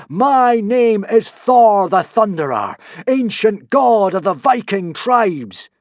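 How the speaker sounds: slow, loud, high proclamation; booming god voice. A narrator's reading. Hiccup as Thor booming his name to the crowd. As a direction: announce yourself as Thor the Thunderer. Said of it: "in a voice he had never used before".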